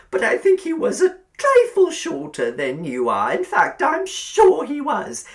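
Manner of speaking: high, loud, cloying landlady voice; sugary old landlady. A narrator's reading. Landlady inviting Billy to sit by the fire, cloying. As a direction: coax him over like a spider.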